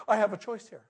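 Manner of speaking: ordinary talk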